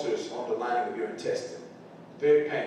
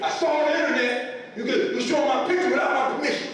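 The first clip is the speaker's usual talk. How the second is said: high, loud, whiny protest with a laugh in it; a mock-outraged kid demanding his rights; indignant teen son; 7 dB louder than usual. Son complains to mom about his photo, high whiny mock outrage. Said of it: "He said".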